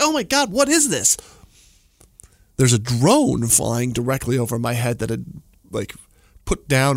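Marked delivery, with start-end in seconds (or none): 0.0-1.2 high startled cry, much higher; retold dream shock. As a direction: gasp it out as if just woken by something weird. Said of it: "I wake up and I'm like".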